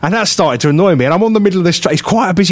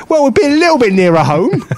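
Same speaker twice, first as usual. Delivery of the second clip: high-pitched indignant comeback; exasperated retort; 9 semitones higher than usual. Retold or imitated. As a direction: retell it as a squeaky protest. Re-enacts retort to driver.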